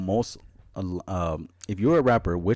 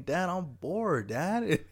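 put-on bored kid; 7 semitones higher, 3 dB quieter, much slower than usual.

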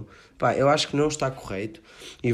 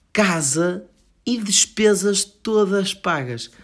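excited; 8 semitones higher, 3 dB louder, slower than usual. Real, not acted. Excited about the deal.

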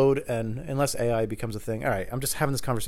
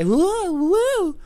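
high mocking woo hoots; 4 dB louder than usual. Real, not acted. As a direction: hoot it high and light. Mock siren-like cheer.

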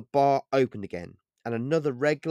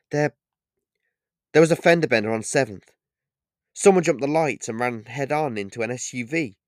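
fast, breathless report; catching breath; 3 semitones higher, 6 dB louder than usual. A narrator's reading. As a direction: blurt it out, still panting. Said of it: "as he started to catch his breath".

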